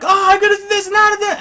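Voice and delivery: put-on high, loud, fast kid voice yelling over others; excited kids. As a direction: hyper nephew shouting plans. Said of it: "nephews that run around and everyone's like".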